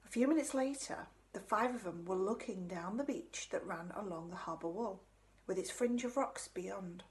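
usual speaking voice

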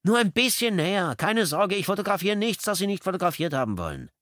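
high, loud pleading voice, speeding up at the end; coaxing photographer. A narrator's reading. Narrator voices Porter pleading.

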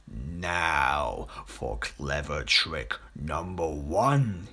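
low, slow, scheming crocodile whisper; a sly hungry crocodile plotting under his breath. A narrator's reading. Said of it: "he whispered to himself".

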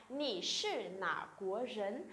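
slow, careful Mandarin question; slow, syllable by syllable Chinese demo; slow model reading. Shown as a demonstration. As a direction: read it out slowly for students to copy. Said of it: "khi người ta hỏi là".

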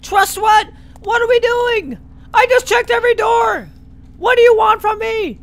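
very high, loud frustrated yelling; exasperated shouting. Real, not acted. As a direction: yell at the top of the voice, fed up. Exasperated outburst, lost in game.

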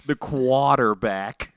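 quarterback with dropped r, slow and loud; Boston quarterback. Retold or imitated. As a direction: draw out quarterback Boston-style. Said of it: "Every time he's like".

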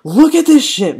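high, loud complaint; exasperated shout. Real, not acted. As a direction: burst out in frustration. Complains about the unfair match.

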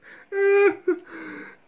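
high, loud burst of laughing; real laughter. Real, not acted. Vlogger reacting to South African accent joke, high real laughter.

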